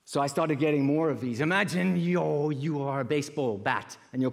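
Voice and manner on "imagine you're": normal talking voice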